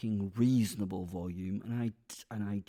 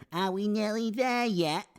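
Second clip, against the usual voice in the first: put-on whiny kid; 11 semitones higher, 7 dB louder than usual. Mock child in back seat.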